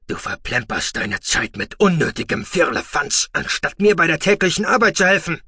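loud, high furious hiss; hissed anger. Acted. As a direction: spit the words at the boy. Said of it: "zischte er".

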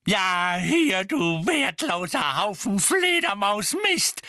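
slow, high, furious screech. A narrator's reading. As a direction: screech each insult out slowly. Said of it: "kreischte Misses Figg".

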